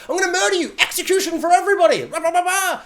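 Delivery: high, loud hammed-up evil ranting; cartoon villain. Evil Colin Farrell threatening everyone, high hammy villain rant. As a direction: play a pantomime villain. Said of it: "evil man who's like".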